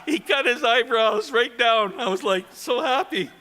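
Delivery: high, loud, laughing all through; real laughing. Real, not acted. Teller recalling the eyebrows cut off, laughing loudly. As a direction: crack up while delivering the punchline. Teller cracking up at own punchline.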